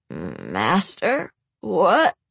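incredulous rising question; baffled, can't believe it; disbelieving. Acted. Raymond in disbelief.

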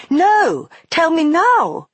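high, loud demand; insistent shout. Acted. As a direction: insist hard, drawing out now. Girl insisting loudly.

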